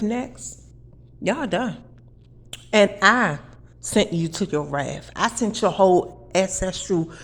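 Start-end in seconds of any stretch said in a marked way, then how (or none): none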